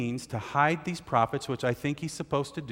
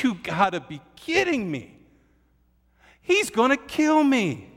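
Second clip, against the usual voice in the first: high, loud frightened protest; panicked disbelief; 10 semitones higher, 5 dB louder than usual. Retold or imitated. Obadiah protesting Elijah's order in acted panic. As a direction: squeal it in mock terror. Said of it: "looks up at him and says".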